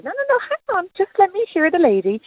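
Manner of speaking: high, eager protest to her son; eager mum pleading. Retold or imitated. Mum insisting to her son she hears the lady, eager. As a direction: jump up high, insisting you stay. Mother retells arguing with son.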